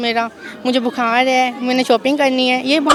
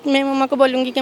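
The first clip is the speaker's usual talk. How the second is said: ordinary talk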